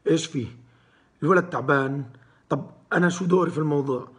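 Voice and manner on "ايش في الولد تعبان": ordinary talk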